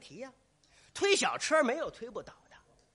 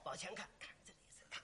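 very soft, trailing to voiceless; fading mutter; 5 semitones lower, 20 dB quieter, much faster than usual. Acted. Actor miming Cao Cao looking ahead, fading murmur. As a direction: let it fade into a whisper.

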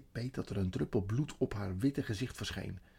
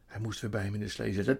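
plain reading voice

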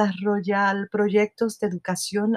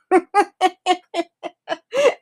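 laugh; 11 semitones higher, 3 dB louder than usual. Real, not acted. Laughing at the Siri story.

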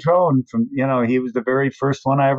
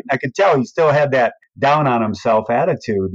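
normal talking voice

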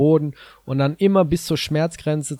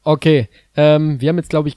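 to someone hard of hearing; 3 semitones higher, 9 dB louder than usual. Real, not acted. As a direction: raise your voice so he hears. Partner can't hear the audio.

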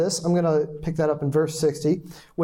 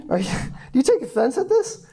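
laughing; 8 semitones higher, faster than usual. Real, not acted. Rereads verse laughing.

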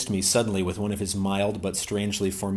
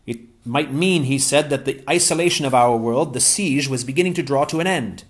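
higher-pitched, eager English gentleman; Ransom's voice; 7 semitones higher, 6 dB louder than usual. Acted. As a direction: pitch Ransom up, glad and keen.

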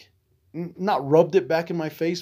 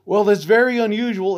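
prissy, higher and louder mock of the clerk; mimicking bank staff; 8 semitones higher, 5 dB louder than usual. Retelling what the bank staff said, a high, louder mock clerk voice. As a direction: parody the clerk's disapproval, pitched up.

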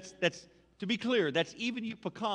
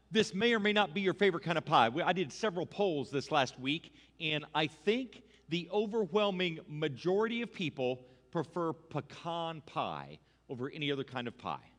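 usual speaking voice

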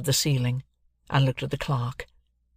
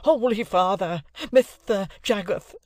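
high, slow, lisping plea; excited cry; 7 semitones higher, slower than usual. Acted. Excitable acquaintance cries to Mister Jaggers, aghast and lisping. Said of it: "cried my excitable acquaintance".

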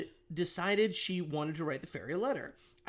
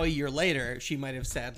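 normal talking voice

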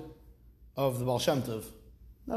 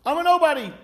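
high, louder self-effacing cry; humble nobody; 10 semitones higher, 6 dB louder than usual. Retold or imitated. An ordinary humble man declaring himself nothing, high and loud. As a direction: say it as if shrinking yourself, pitched up. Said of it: "A guy decides".

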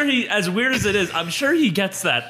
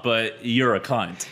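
slow deadpan insult; 6 semitones lower, slower than usual. Pretend. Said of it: "but in your voice".

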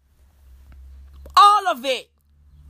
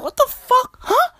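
high-pitched startled outburst; mock bewilderment; 12 semitones higher than usual. Comedian reacting to the boasting minister, high mock bewilderment. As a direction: squeal it in acted disbelief.

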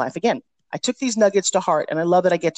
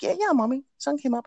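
higher, a lightly voiced little girl; little daughter voice; 7 semitones higher, 4 dB quieter than usual. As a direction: answer like a small child, a hint of kid voice. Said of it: "She's like".

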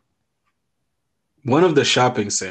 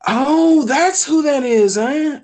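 surprised realization; 6 dB louder, slower than usual. Real, not acted. Recognizes Brewster.

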